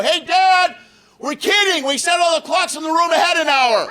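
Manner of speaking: high, loud laughing call down the hallway; daughter yelling. Retold or imitated. Teenage daughter calling after her panicked dad, high laughing yell. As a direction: shout it gleefully from far off. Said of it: "my oldest daughter yell after me".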